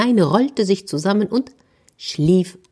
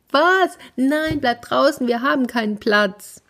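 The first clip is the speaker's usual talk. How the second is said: much higher, alarmed little animals; shrill refusal; 9 semitones higher than usual. A narrator's reading. Animals inside refusing the boar, high alarm. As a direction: squeal no in a panic. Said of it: "animals refuse the boar".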